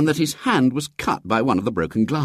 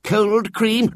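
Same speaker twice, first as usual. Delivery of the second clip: higher, fussing apologetic curate; fawning vicar; 10 semitones higher than usual. A narrator's reading. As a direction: fall over yourself apologizing. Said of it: "said the vicar".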